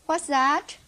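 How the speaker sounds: usual speaking voice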